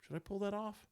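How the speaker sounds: a high, unsure inner voice; high questioning voice. Retold or imitated. Voicing his own doubtful thought.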